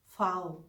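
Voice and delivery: फाओ with f sound; German V. Shown as a demonstration. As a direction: say फाओ, f not v. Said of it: "वी को फाओ बोलते हैं". (वी को फाओ)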